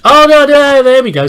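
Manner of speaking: loud, high yelp of dismay; real startled outburst, easing off; alarmed cry. Real, not acted. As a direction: cry out as if it is slipping away. Alarm at nearly dropping a body.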